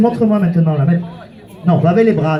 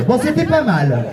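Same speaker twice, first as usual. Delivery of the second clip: usual speaking voice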